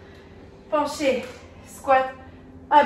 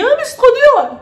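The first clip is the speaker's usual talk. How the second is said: high, loud whiny protest; imitating a friend's whiny complaint; mock complaint; 8 semitones higher, 7 dB louder than usual. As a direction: whine it like a lazy friend. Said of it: "vous avez dit".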